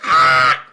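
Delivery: short, loud imitation of startled kids; sudden comic "Ah!" of alarm; mock scream. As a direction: yelp like kids caught at gunpoint, played for laughs. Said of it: "And they're all like".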